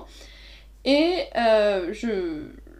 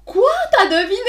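much higher, loud astonished question; mock surprise; 9 semitones higher, 6 dB louder than usual. Teasing a friend about her reaction, high mock astonishment. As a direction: act amazed that she guessed. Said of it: "t'étais en mode".

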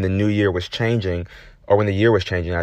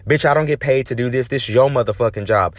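ordinary delivery

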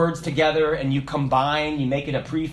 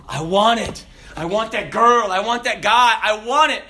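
louder, higher insistent wanting; a voice that won't let go of wanting; loud craving; 5 semitones higher, 4 dB louder than usual. Acted. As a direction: demand it out loud.

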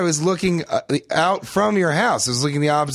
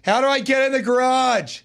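shouting across the house; 9 semitones higher than usual. Pretend. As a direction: call out, lost in the house. Shouting, can't hear.

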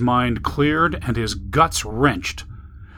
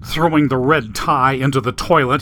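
ordinary delivery, nothing marked

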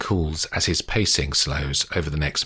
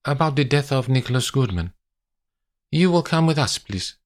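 higher-pitched, insistent Italian officer's English; Italian policeman; 8 semitones higher than usual. Acted. Police officer orders Olivia to come along, firm Italian-accented English.

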